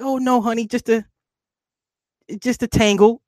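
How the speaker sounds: high-pitched placating dad voice; falsely reassuring dad. Retold or imitated. Dad hides the staples from his daughter, high-pitched reassurance. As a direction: cover up in a high, sweet-talking voice.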